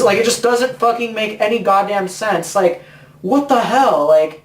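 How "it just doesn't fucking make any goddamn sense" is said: usual speaking voice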